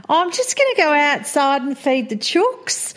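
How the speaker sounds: put-on chirpy country Australian accent, off to the chooks; rural Australian accent. Mother imitating her daughter's rural Australian accent on the phone. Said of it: "it sounds like she's just about to say".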